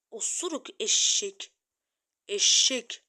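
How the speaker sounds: loud, slow, jeering name-calling; angry insult. Real, not acted. Jeering at the enemy player, loud angry insult.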